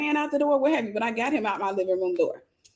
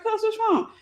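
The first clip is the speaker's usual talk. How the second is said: lightly raised, unbothered question; nonchalant; 11 semitones higher than usual. Retold or imitated. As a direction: ask like nothing's happening. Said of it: "Just as calm".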